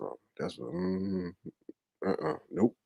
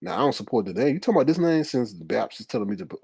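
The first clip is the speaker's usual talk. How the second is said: ordinary delivery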